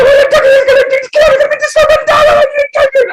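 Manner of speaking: put-on very high, loud frightened kid voice; scared child's voice. Boy watching Doctor Who squeals in a high scared voice. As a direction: squeal it like a terrified little boy.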